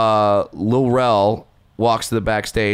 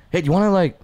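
higher, chummy backstage ask; puppeteer's offer; 7 semitones higher, faster than usual. Retold or imitated. Puppeteer inviting the comic to join, mild imitation. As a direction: pitch him the bit casually.

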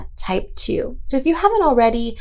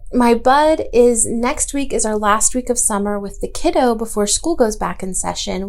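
ordinary talk, nothing marked

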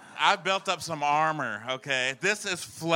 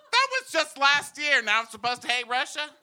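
high-pitched comic protest; incredulous mock outrage; 10 semitones higher than usual. Comic objecting to hating Russia now, incredulous outrage. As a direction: protest the sudden reversal in disbelief.

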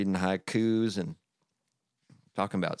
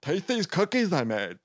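high, excited put-on voice; high-pitched excited invitation; eager offer; 11 semitones higher than usual. A narrator's reading. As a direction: urge a friend eagerly in a high voice. Toad urges Frog to taste.

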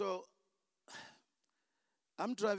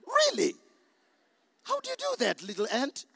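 much higher and louder, teasing the little ant; mock disbelief; 6 semitones higher, 7 dB louder than usual. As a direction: scoff at the silly idea in a high voice. Mocking the ant protecting a lion.

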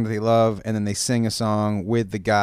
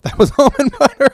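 laughing; 7 dB louder, faster than usual. Real, not acted. Laughing at own story.

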